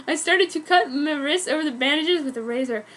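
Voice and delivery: usual speaking voice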